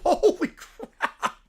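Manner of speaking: slow laughing holy crap; cracking up; words stretched through laughter. Real, not acted. Laughing reaction.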